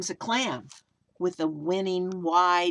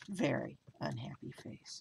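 soft, lower mopey voice; mock sad; 4 semitones lower, 11 dB quieter than usual. A narrator's reading. Storyteller to kids, softly moping about the face. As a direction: sigh it out to the kids.

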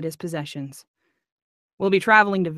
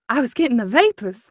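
Southern belle; 5 semitones higher, 4 dB louder than usual. Pretend. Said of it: "had to fan yourself".

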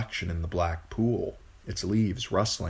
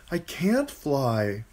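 put-on higher, slow plaintive boy voice; frightened boy; 6 semitones higher, much slower than usual. A narrator's reading. Bran answering the voice as he falls, plaintive boy. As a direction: protest helplessly, stretching the words.

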